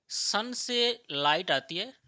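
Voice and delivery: सन से लाइट आती है said loud and slow, हर शब्द पर ज़ोर; slow emphatic stress. Shown as a demonstration. Teacher drilling the class on sunlight, loud and slow. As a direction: drag it out loudly so students catch it.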